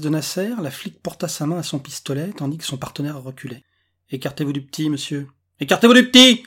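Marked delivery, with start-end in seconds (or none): 5.6-6.4 loud, high-pitched police command; shouted order. A narrator's reading. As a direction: bellow the order at higher pitch. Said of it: "répéta inutilement le collègue". (ordre crié, fort et aigu)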